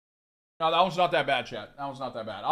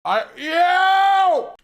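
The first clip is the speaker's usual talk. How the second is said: high, loud yell of pain; shout; 12 semitones higher, 7 dB louder than usual. Real, not acted. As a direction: yell out from the burn. Reacting to extreme heat.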